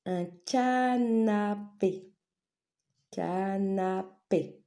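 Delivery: "canapé" slowly as CA-NA-PÉ; syllable-by-syllable. Shown as a demonstration. Teacher naming a picture for pupils, syllable by syllable. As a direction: draw out each syllable of "canapé".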